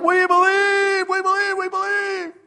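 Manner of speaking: very high chanted shout, imitating a crowd; drawn-out high crowd cheer; wild crowd. Crowd roaring back to the tightrope walker, wild high chant. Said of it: "the crowd goes wild".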